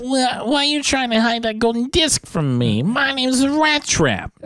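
Rat Trap voice. Retold or imitated. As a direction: squeak it like a cartoon rat, slowing at the end. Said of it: "And then Rat Trap's like".

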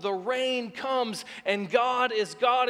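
ordinary delivery

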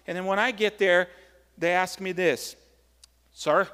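ordinary talk

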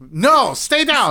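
high, slow, drawn-out command; mock director's order. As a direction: order the actress down, hammed-up. Acting director ordering actress down.

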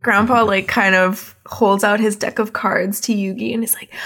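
ordinary talk, nothing marked

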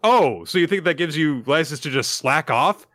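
put-on high, loud scornful matron berating a girl; scolding Lady Patriot. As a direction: sneer it down at her from above. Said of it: "Lady Patriot says".

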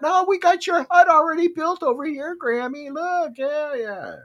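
high, eager welcome; welcoming islanders. Retold or imitated. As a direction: wave her over, delighted to see her. Islanders welcoming Grammy to her hut.

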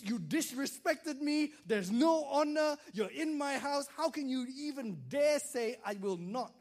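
a hammed-up furious dad rant. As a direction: scold your disrespectful son, building.

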